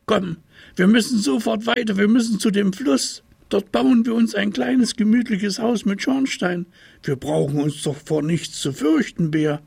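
an excited small tiger rushing bear on; eager little tiger. A narrator's reading. Little tiger urging bear toward the river, high excited voice.

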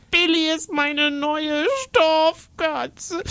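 put-on a slow, high, tearful little girl; lightly sobbed child line; sobbing girl. Girl telling her aunt, mildly tearful. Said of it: "schluchzt Käthe".

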